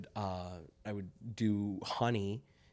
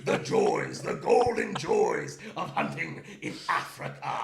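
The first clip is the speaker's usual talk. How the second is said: grand, breathy, laughing proclamation; Theodore Roosevelt stage voice; 8 semitones higher than usual. Shown as a demonstration. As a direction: savour it like a boastful hunter. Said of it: "that is the actual line".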